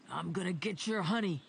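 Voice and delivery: lightly growled teasing threat; growly taunt. A narrator's reading. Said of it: "he taunted the dog in a growly voice".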